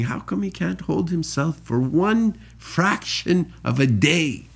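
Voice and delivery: high, laughing disbelief; half-laughing, mock outraged angel. Angels mocking human, laughing.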